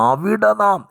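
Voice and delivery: അവിടെ lifted sharply high; stress on അവിടെ. Shown as a demonstration. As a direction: ring out "അവിടെ നാം" as the key words. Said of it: "എന്ന വാക്കാണ് ഇഷ്ടപ്പെട്ട".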